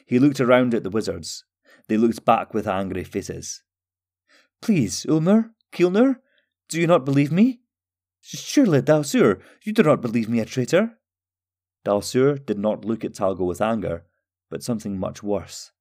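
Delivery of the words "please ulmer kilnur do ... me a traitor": high-pitched, anxious appeal; pleading. A narrator's reading. Talgo begs the wizards to believe him, high anxious plea. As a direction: beg to be believed, voice rising into each question.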